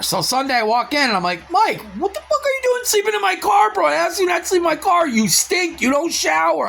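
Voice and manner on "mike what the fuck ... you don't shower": retold blowup at Mike. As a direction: lay into him like you're fed up. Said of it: "I went off".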